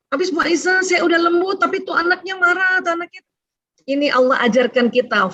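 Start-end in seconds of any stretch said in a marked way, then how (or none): 0.1-3.2 high-pitched mock parent's protest; complaining parent. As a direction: play a parent pleading their case in a raised pitch. Voices a parent's complaint to the teacher.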